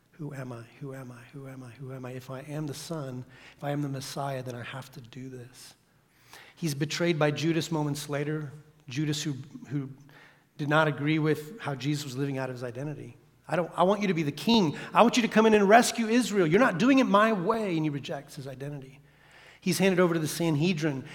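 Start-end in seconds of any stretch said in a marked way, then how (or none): 0.1-5.7 hushed inner wrestling. Acted. Voicing Jesus's wrestling in the garden. 13.5-17.8 raised, higher voice of frustrated protest; insistent demand. Acted. Acting out Judas's angry demand.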